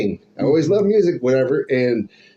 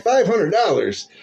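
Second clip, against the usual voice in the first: shocked disbelief; 7 semitones higher, slower than usual. Retold or imitated. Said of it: "I'm like".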